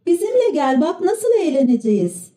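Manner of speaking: put-on one reader suggesting children shouting together, mildly; high group-style call without real volume; chorus shout. A narrator's reading. All the children urge Pinokyo together, mild high chorus shout. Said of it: "çocuklar koro halinde bağırıştılar".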